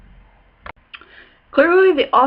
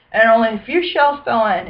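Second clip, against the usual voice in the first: ordinary delivery, nothing marked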